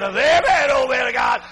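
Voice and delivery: loud high Amen, oh man of God; mock shout of praise. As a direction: call it out like a hallelujah. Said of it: "I just looked at him and said".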